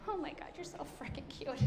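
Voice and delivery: hushed, awestruck gushing; smitten. Retold or imitated. Newcomer smitten on meeting a colleague, hushed mock gushing. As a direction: gush under your breath, as if starstruck. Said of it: "And I'm just like".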